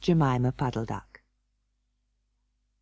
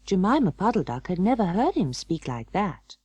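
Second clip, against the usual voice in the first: ordinary delivery, nothing marked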